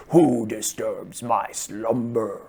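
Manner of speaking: slow, drawn-out booming voice; imitated booming voice, very slow; Cave of Wonders. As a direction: draw each word out like an ominous movie guardian. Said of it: "I hear this very booming voice".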